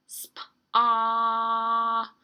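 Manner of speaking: "Sp. Ah." with a loud, long held vowel; stretched-out word. Shown as a demonstration. Teacher helping children hear letters, stretched-out sounds. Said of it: "try to stretch out that word".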